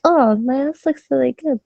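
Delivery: admiring self-quote. Retold or imitated. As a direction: imitate admiring yourself. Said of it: "I'm like".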